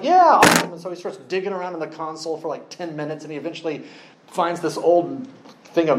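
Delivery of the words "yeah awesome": high, keen mock yes; eager passenger. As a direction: play the overeager passenger agreeing. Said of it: "and he's like".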